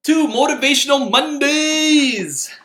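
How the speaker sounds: big, high, drawn-out show title; loud announcer flourish, stretched to the end; show host announcement. Real, not acted. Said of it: "I sound like a guest show host".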